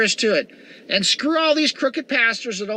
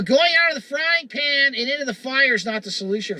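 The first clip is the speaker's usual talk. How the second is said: normal talking voice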